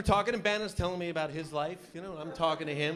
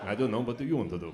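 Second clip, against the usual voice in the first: low-pitched big-guy reply; huge football player; 6 semitones lower than usual. Acted. One huge guy answering another, low big-guy voice. Huge guys asking each other.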